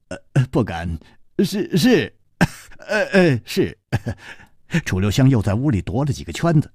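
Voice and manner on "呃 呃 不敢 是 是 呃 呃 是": nervous laugh. Acted. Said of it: "哈哈笑道".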